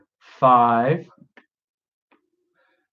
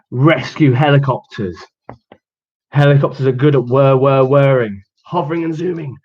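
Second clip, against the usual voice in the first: lively storybook read with rhythmic sounds; reading to children; 5 dB louder than usual. Acted. Teacher reading the digger story to children, lively. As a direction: read it out playfully for little ones. Reads picture book to kids.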